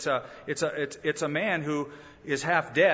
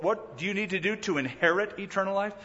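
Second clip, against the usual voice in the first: normal talking voice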